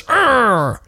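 short frustrated "urgh", pitched high; high, exasperated growl; angry growl. Acted. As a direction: let out one brief growl of anger. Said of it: "out of his anger".

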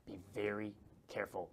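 ordinary talk, nothing marked

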